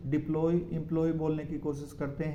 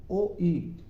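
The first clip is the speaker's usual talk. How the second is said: normal talking voice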